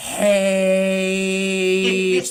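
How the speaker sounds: "H" as "haitch", the vowel stretched out long; grating mispronounced H. Acted. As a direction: drag "H" out into an endless, grating haaaitch. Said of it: "like nails on a chalkboard".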